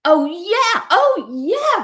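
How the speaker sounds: high, eager oh yeah; excited. A narrator's reading. Raccoon feeding Duck's panic, high and eager. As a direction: egg the fear on, thrilled.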